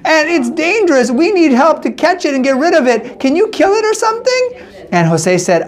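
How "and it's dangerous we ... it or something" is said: put-on small scared children begging; frightened kids. Kids beg the man to kill the alligator, high and pleading. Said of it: "They said".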